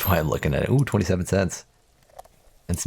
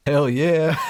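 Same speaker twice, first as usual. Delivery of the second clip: higher, louder hell yeah; triumphant cheer; 9 semitones higher, 4 dB louder than usual. Real, not acted. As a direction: cheer it out, pumped. Triumphant about the hat.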